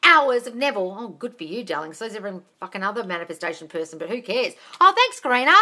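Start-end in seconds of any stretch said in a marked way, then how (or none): 0.0-1.0 louder mimicked boast; loud, mocking imitation of Candace bragging. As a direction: ham up the know-it-all. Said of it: "she's going".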